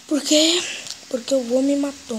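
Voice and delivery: loud frustrated outburst; raging gamer. Real, not acted. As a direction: yell in disbelief at dying. Gamer raging after death.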